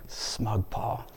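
very soft, whispered aside; hushed aside. Acted. Preacher voicing Jesus looking down on Paul, hushed aside. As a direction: drop to a low-volume murmur, nearly all breath.

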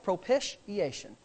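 "Propitiation" sounded out slowly; careful pronunciation. Real, not acted. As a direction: test "Propitiation" syllable by syllable, slowly and softly. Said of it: "Am I saying it right?".

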